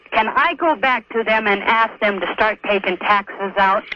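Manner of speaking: ordinary talk, nothing marked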